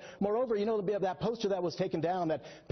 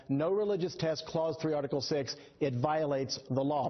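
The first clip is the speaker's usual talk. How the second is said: ordinary talk, nothing marked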